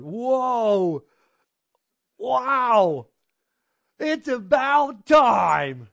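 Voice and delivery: loud, high, drawn-out wow; slow, loud, high cry of wonder; excited exclamation. Real, not acted. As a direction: burst out in amazed delight. Preacher reacts with amazement.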